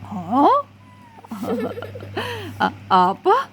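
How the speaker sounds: stammering shocked "Hah?" with a giggle; mock startled stammer; startled "what?". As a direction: caught off guard, stumbling over "apa". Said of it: "Itu mengejutkan sekali".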